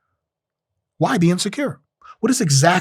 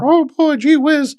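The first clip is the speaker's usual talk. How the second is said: much higher, eager gee-whiz schoolboy voice; fifties kid character; 4 dB louder, slower than usual. Pretend. Fifties TV kid voice to shrug off insecurity, much higher and eager. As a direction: play the wholesome fifties kid to laugh at your flaws. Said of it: "turn on the fifties kid character".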